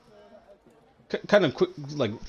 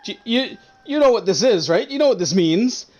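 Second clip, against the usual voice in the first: frightened; 8 semitones higher, 4 dB louder than usual. Acted. Shopkeeper shows the token, frightened.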